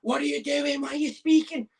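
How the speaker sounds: a hint of a prissy fan, pitched high; lightly voiced sniffy neighbour in the stands; mock indignant shusher. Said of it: "someone turns around and goes".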